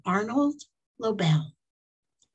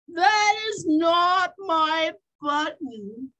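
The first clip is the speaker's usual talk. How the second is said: high, drawn-out wail; long-stretched high lament; 9 semitones higher, slower than usual. A narrator's reading. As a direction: wail it slowly in protest. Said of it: "wailed Toad".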